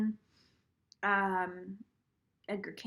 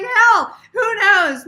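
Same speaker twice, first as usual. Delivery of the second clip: much louder and higher, devil-may-care; reckless excitement; 9 semitones higher, 14 dB louder, much slower than usual. Retold or imitated. Telling viewers her reckless thought, loud excitement. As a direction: toss it off with wild bravado.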